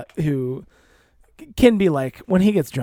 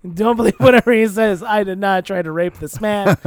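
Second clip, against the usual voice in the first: mock protest shout; 4 semitones higher, 6 dB louder than usual. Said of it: "I'm just like".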